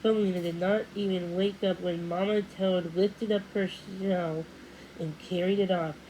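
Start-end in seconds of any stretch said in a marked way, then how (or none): none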